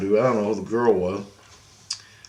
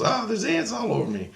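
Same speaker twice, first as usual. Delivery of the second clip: mock distress, pitched way up; high girl's exclamation; 12 semitones higher, 4 dB quieter than usual. Girl finds ants all over herself, high mock alarm. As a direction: imitate a girl in a high, thin-volume voice.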